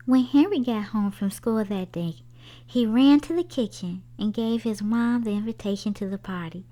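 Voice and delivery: plain reading voice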